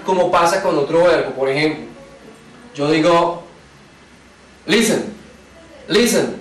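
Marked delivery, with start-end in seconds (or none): 4.7-5.0 "Listen" stressed on LIS, falling away after; stress on first syllable. Shown as a demonstration. Teacher showing where the accent is, "Listen" stressed at the start. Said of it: "Está al principio". 5.9-6.4 "Listen" stressed on LIS, falling away after; stress on first syllable. Shown as a demonstration. Teacher showing where the accent is, "Listen" stressed at the start. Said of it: "Está al principio".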